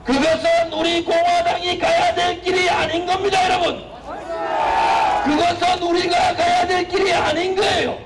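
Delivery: high, impassioned rally shouting; roaring rallying speech. Real, not acted. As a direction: preach it to the crowd at full force.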